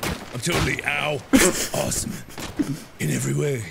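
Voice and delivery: Cinnamon Toast Ken voice. Retold or imitated. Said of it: "'Cause I'm Ken".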